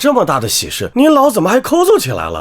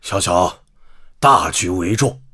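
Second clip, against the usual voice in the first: low scheming voice; 7 dB quieter than usual. Acted. Relative scheming, low conspiratorial advice.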